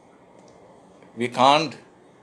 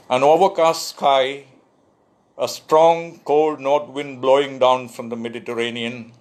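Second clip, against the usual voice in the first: louder, higher measured recital; poetry reading; 4 semitones higher, 4 dB louder than usual. Acted. Poet reading his Libya poem to listeners, measured recital. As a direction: give each image its moment.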